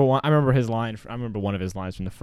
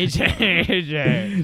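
laughing; 9 semitones higher, 7 dB louder, much slower than usual. Real, not acted. Laughing calls to friend.

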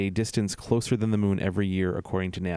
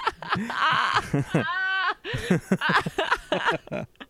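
high laugh. Real, not acted. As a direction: burst of high-pitched laughter. Laughs after his impression.

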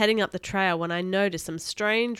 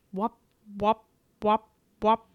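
imitated helicopter whipping noise. A narrator's reading. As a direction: mimic rotor blades coming nearer. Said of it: "a strange whipping noise".

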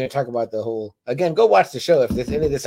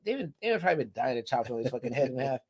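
normal talking voice

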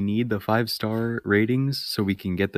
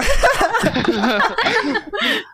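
high burst of laughter. Real, not acted. Laughs at shirt joke.

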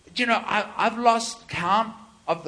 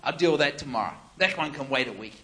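unbothered shrug; 7 semitones lower, 6 dB quieter, faster than usual. Retold or imitated. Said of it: "it's just like".

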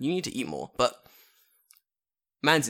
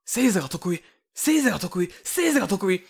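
imitated shouted chant, higher and louder; loud, raised repeated cry; screaming; 7 semitones higher, 7 dB louder than usual. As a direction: mimic a ranter yelling a slogan over and over. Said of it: "he just starts screaming".

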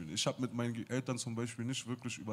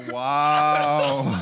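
very loud, drawn-out, higher-pitched wow; mock amazement; 5 semitones higher, 15 dB louder than usual. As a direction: imitate being hugely impressed, way over the top. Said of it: "du bist so".

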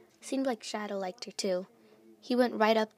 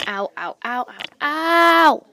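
repeated ows building to a loud long howl; pained yelps; 5 semitones higher, 15 dB louder, much slower than usual. Acted. Luna just punched a tree, pained yelling. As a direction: yelp in pain, ending in a big drawn-out cry.

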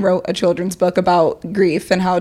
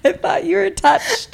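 slow words through suppressed laughter; fighting back the giggles; stifled giggles; 9 semitones higher than usual. Real, not acted. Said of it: "I'm trying not to laugh".

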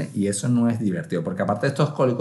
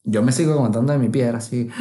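ordinary talk

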